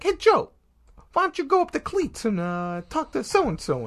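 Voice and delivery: high-pitched put-on bookie voice; bookie impression. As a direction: imitate the old boss giving a kid errands. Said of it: "he talked like this".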